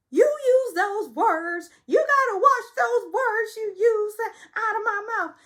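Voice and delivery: high, loud, slow tearful shriek; very high, loud mock wail; mock shrieking Sutton. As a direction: shriek it out slowly in mock tears. Sutton shouts at Crystal.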